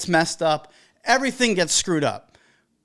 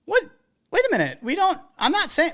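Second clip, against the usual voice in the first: high, disbelieving mock bride; imitating a stunned bride, much higher; mock shocked bride; 8 semitones higher than usual. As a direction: act the stunned client, pitch jumping up. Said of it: "And they were like".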